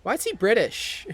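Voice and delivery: loud, high, baffled question; incredulous outburst. Real, not acted. Streamer reacting to a character's British voice, incredulous. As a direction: blurt it out in real disbelief.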